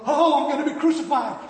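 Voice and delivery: loud, high wail of dread; hammed-up terrified outburst; panicked prisoner. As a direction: cry out like a cornered man facing death. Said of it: "you wince like an animal in the corner".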